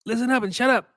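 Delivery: mocking a colleague telling everyone to listen, mock bossy. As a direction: imitate a colleague barking orders, hammed up. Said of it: "He's like".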